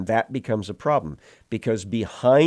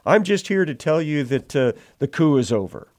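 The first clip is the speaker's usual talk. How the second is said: high, fast, nervous report; evasive messenger; 7 semitones higher, 5 dB louder, faster than usual. Acted. Messenger evading the king's question, high and fast. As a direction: hurry through the excuse like a flustered messenger. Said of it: "He says".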